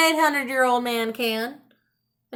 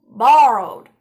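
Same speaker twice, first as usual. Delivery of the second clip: louder, falling insistence; firm correction; 3 semitones lower, 7 dB louder than usual. A narrator's reading. Wizard corrects the old man, loud and firm. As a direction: set him straight.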